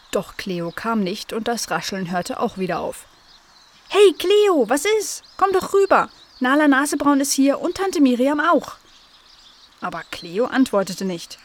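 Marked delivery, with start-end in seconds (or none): 3.9-8.8 high, lively call to a sister. Acted. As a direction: call her over, pitched high and louder. Calls sister over through the hedge. (hohes, munteres Herüberrufen)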